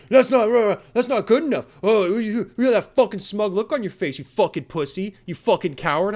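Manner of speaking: loud, angry insults flung at him; imitated yelling. Neighbor insulting the dog owner, loud and angry. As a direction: bark the insults like the neighbor did.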